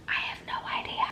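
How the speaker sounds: soft whispered aside; whispering. Real, not acted. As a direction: whisper it to yourself. Aside after wondering about contractions.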